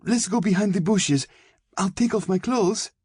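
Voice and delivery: very high, distraught begging; frightened girl's high-pitched babbling plea; desperate pleading. A narrator's reading. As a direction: plead frantically through pain and fear. Beaten girl begging attacker to stop.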